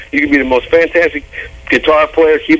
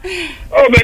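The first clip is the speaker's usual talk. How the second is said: ordinary talk, nothing marked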